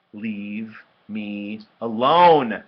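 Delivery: very slow, word by word, swelling loud; angry demand. A narrator's reading. Brother on his feet sending sister away, angry. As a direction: space out each word, building to a yell.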